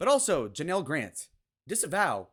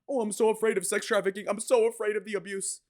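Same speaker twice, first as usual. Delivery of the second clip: high-pitched, put-on victim whine; mock fear; 7 semitones higher, 3 dB louder than usual. Host ridiculing the accuser's claims, mock fear. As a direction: mimic a frightened woman sarcastically, pitched well up.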